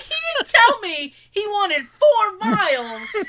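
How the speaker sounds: high, loud, slow indignant complaint; outraged high protest. Retold or imitated. Telling the nurse about the doctor's order, high and outraged. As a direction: squeal it out in disbelief.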